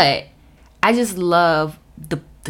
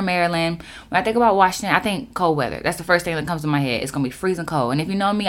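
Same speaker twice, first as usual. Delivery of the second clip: ordinary delivery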